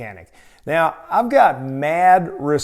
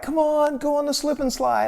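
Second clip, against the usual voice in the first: mock childish begging, pitched much higher; pleading kids; 10 semitones higher than usual. Wife and little brother urging tired husband onto the slide, high wheedling voice. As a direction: pester him like an eager little kid.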